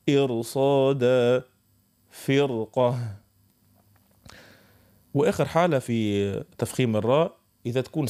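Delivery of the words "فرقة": فرقة drawn out very slowly, thick heavy R. Shown as a demonstration. Teacher models the heavy R in فرقة, slow. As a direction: hold فرقة long with a heavy R. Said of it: "ديما تقعد في التفخيم".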